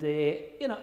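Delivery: ordinary talk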